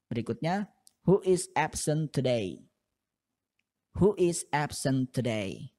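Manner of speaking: usual speaking voice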